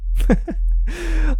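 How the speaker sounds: high, distant mocking giggle; teasing giggle. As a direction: laugh at the listener's weakness. Villainous laugh at listener.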